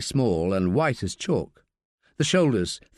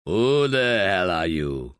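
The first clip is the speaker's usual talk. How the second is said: gruff man voice; 6 semitones lower, slower than usual. Acted. Gruff coroner Piquet voiced.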